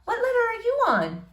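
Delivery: put-on shy girl greeting a boy she likes.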